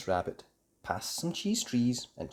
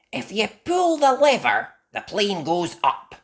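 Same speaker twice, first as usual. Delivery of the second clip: high, loud instructing; Mandy explaining; 11 semitones higher, 9 dB louder than usual. Acted. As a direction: show friends how it works.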